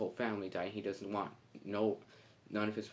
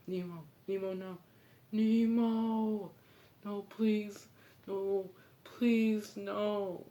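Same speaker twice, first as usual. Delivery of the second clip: desperate pleading; 11 semitones higher, much slower than usual. Retold or imitated. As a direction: beg please, cry it out high. Father calls for lost son.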